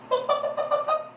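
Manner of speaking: high happy giggle; little squeaky laugh. Real, not acted. Laughing while praising puppy.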